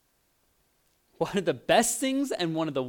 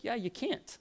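gentle Jesus voice; 8 semitones higher, 7 dB quieter than usual. Acted. Jesus answers the worried believer.

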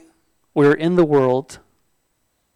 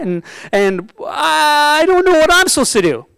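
pitched way up, laughing and near tears; high, worked-up sermon; 8 semitones higher than usual. Real, not acted. Pastor telling the congregation about being the awkward newcomer, high and emotional. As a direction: plead with the room to feel it.

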